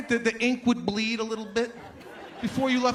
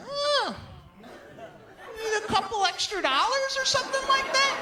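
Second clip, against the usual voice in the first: very high, sing-song condescending offer; mock sweet offer. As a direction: coo it like a helpful friend. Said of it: "they're like".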